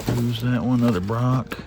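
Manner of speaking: ordinary talk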